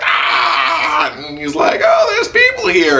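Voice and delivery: high squealing startled cry; mock shocked Sion. Reviewer mimicking Sion discovering the slums, high shriek. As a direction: shriek in naive surprise.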